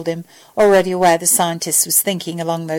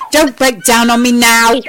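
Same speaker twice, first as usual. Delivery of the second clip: loud, raised exasperated plea; 4 semitones higher, 6 dB louder than usual. A narrator's reading. Al pleads with the failing device, exasperated. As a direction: urge it loudly, at the end of patience. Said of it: "he said, exasperated".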